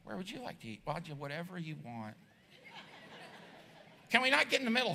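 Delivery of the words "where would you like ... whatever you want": much softer, meek mock voices; hushed overly polite couple; timid politeness. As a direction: shrink back and defer. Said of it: "It's like".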